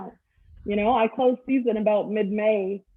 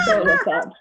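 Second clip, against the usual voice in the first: high squeal; 7 dB louder than usual. Pretend. Sassy mom punchline.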